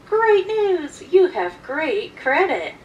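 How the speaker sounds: high, loud, overblown announcement; peppy salesman imitation, slowing at the end; mock excited salesman. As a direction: deliver it like thrilling sales news. Salesman's news, acted.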